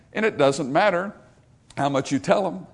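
usual speaking voice

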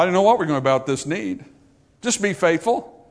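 ordinary talk, nothing marked

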